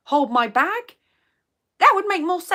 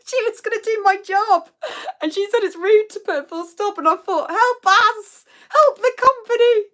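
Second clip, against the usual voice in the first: much higher, through laughter; laughing disbelief; 10 semitones higher, 4 dB louder than usual. Real, not acted. Laughing to viewers about her replacement. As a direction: crack up while pleading. Laughing exasperation retelling story.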